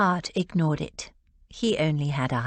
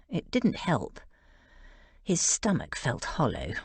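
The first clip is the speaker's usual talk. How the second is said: ordinary delivery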